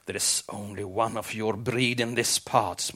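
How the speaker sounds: ordinary delivery, nothing marked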